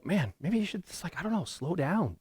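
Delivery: musing aloud as an onlooker, lightly and high; mock gentle advice. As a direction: voice a hesitant suggestion, high and soft.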